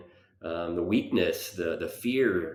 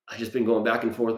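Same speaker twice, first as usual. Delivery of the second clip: ordinary delivery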